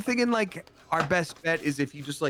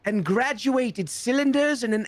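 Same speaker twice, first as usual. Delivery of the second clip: high, slow nerdy listing; 10 semitones higher than usual. Pretend. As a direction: savour each science word.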